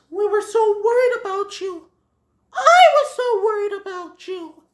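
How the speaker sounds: high, loud, emotional character voice; worried Feline. Acted. Feline tells found Bambi her worry, high distraught voice.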